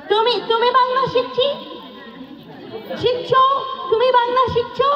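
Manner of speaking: lilting high Bengali question to crowd; high sing-song question. Real, not acted.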